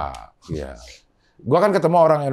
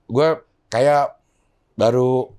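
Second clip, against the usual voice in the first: normal talking voice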